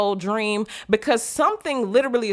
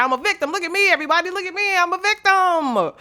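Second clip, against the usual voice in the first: high whiny victim voice; mock self-pity; 9 semitones higher than usual. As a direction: ham up a pitiful victim begging for attention. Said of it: "sit here and cry".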